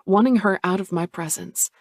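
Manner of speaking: ordinary delivery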